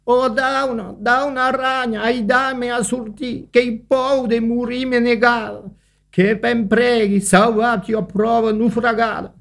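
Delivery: high, thin, begging ant voice; a small frightened ant calling for help; pleading little ant. A narrator's reading. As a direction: plead for rescue in a tiny high voice. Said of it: "E cu cride en sue lati".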